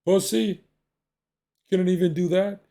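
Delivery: retelling the dad's scornful put-down; mock contemptuous dad. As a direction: sneer at the son like a scornful father. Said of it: "he's like this".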